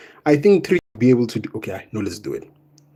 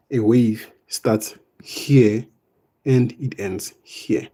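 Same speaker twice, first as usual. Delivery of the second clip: slow emphasis; much slower than usual. Shown as a demonstration. Teacher stressing key definition.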